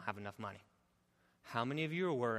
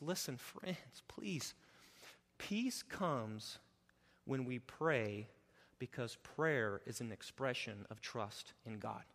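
much softer, slow, tender appeal; gentle plea; 5 dB quieter, slower than usual. Acted. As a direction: lean in and plead quietly. Pleading softly with congregation.

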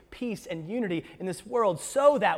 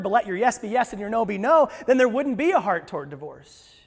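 loud, rising preacher's build; preaching at full force; 4 semitones higher, 10 dB louder than usual. Acted. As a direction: drive it home to the congregation.

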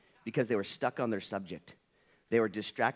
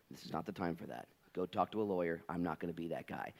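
a touch softer and lower; gentle but clear; 4 semitones lower, 6 dB quieter than usual. Retold or imitated. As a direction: set him straight kindly. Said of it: "gently but clearly".

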